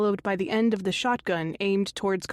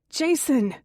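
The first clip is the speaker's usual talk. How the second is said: higher-pitched call to someone inside; 6 semitones higher, 4 dB louder than usual. A narrator's reading. Narrator calls to Jason inside the house, calling out. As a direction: call the name out urgently. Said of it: "I call out".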